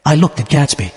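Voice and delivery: ordinary delivery, nothing marked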